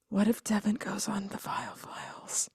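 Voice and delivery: hushed, breathy horror whisper; dramatic whisper. Pretend. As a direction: whisper it like a ghost story. Dramatic whispered terrible thought.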